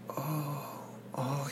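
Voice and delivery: hushed, imitating a friend taking in bad news; soft dismay. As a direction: let it drop away quietly as it sinks in.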